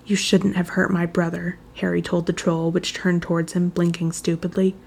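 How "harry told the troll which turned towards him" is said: ordinary delivery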